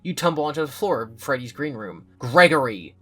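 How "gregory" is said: a loud angry yell of his name; bursting out of the trap in anger; mad yell. A narrator's reading. Cassie confronting Gregory after escaping, loud angry yell. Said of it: "You're so mad".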